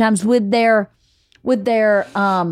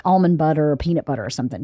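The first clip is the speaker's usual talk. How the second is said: ordinary talk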